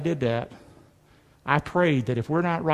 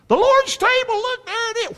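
high squeaky mock voice of a churchgoer. Preacher mocking a complacent churchgoer, high squeaky voice. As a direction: lift way up into a silly put-on voice.

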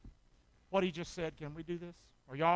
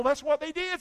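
ordinary talk

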